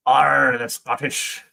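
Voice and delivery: a loud, mock pirate "Arr"; pirate growl. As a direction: bark out "Arr" like a cartoon pirate. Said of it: "Scottish is a pirate accent".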